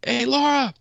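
high, loud call across a bar; yelling across bar. Retold or imitated. Retelling calling his wife Laura over at the bar, high loud yell. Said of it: "I yelled across the bar".

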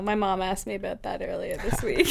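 whispered through laughs. Real, not acted. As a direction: hush it, half laughing. Whispers the embarrassing fact.

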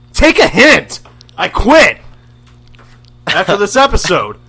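loud, high outburst; shouting. Acted. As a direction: yell it like you're storming off. Shouting his quitting.

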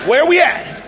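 high loud alarmed asking; startled question. Retold or imitated. Man waking in the car asks where they are, startled. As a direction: jolt awake and ask.

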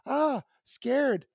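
put-on high-pitched little-kid fright; imitating scared toddler. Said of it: "Mary goes".

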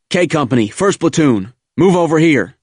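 high, loud command calls; shouted orders. A narrator's reading. Officer rallying first platoon on the beach, shouting orders. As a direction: call the troops over, shouting. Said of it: "yelled and shouted orders".

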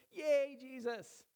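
loud, high, slow cheer; sing-song mock celebration of the hero. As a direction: cheer it like a fan in the crowd.

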